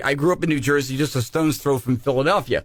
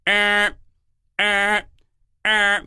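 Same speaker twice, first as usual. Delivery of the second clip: short, evenly spaced beeps, raised in pitch; imitated busy signal; 4 semitones higher, much slower than usual. Showing what callers heard on the line, busy-signal beeps. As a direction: beep like a phone's busy tone. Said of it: "there was a beep going".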